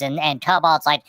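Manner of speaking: ordinary delivery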